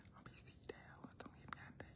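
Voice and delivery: breathy aside under the breath; whisper. Real, not acted. Aside while streaming, whispered. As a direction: whisper it off-mic. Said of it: "whispered aside".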